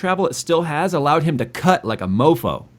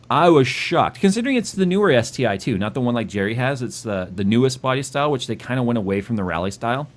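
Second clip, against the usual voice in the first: ordinary delivery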